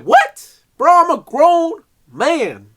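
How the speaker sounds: high, loud, outraged "What?" then slow protest; hammed-up indignant yelp; indignant shout. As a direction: play offended, a grown man insulted. Mock indignant reply to brother.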